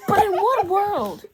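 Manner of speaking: high, loud laughing outrage; laughing disbelief. Real, not acted.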